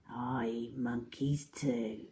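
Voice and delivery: low, slow, soft threat with a grin; grinning crocodile softly threatening; quiet menace. Acted. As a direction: purr the threat slyly.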